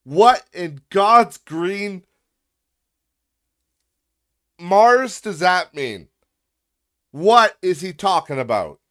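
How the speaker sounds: high, loud rant of disbelief; exasperated shouting. Acted. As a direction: yell in bafflement, stretching the words. Baffled rant about the comment.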